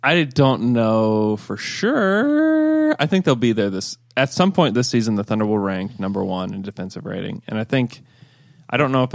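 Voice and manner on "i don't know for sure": very slow, drawn-out answer. Real, not acted. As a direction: stretch every word.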